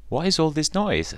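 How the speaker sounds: put-on high-pitched elderly fussing; old woman. A narrator's reading. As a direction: demand it as a startled old lady. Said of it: "said an old woman".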